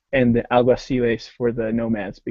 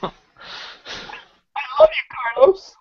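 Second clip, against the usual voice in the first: mock tearful shout; 12 semitones higher than usual. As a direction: wail it like an overjoyed fan. Gushing mock love to Carlos.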